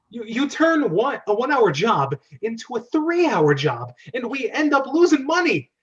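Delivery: high, loud furious rant; exasperated boss yelling at his brother; angry scolding. Acted. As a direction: chew him out for wasting hours. Angry Mario scolding Luigi.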